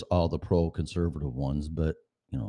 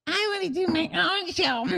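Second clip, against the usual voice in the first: much higher, squeaky mock imitation of the friend. Host mocking his friend's wish for her own show, high and squeaky. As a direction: parody her in a silly high voice. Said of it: "No, she didn't say that. I'm kidding".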